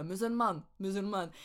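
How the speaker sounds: put-on "musulmane" in a makeshift English accent; fake English accent. Learner showing her mistaken word, fake English accent. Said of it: "je mets un accent anglais".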